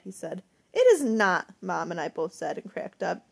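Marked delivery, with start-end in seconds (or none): 0.7-1.5 indignant protest. A narrator's reading. Mom and daughter protest the teasing, loud indignant retort. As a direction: protest it in a sudden loud burst.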